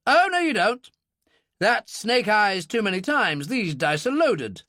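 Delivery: an officer calling out cheats; indignant military man. Acted. Military man accuses the club.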